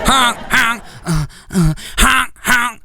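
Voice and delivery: high honking clown noise; mocking the funny rival with high clown honks. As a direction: honk like a clown horn.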